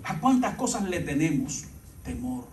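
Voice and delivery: low, soft, measured appeal with long pauses; quiet, deliberate reassurance to the congregation; hushed preaching. Acted. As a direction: bring the sermon down to an intimate hush. Slow, hushed sermon appeal.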